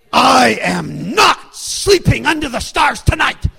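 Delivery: loud, high, furious mock shout; hammed-up outrage, hitting each word hard; fiery refusal. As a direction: imitate a wife erupting in defiance. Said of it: "with fire in her eyes".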